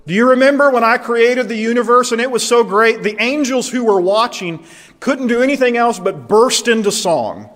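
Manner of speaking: high, loud, swelling recollection; God reliving a triumph at full volume; exultant God. Acted. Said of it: "God says".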